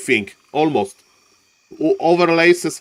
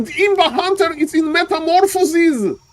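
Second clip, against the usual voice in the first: usual speaking voice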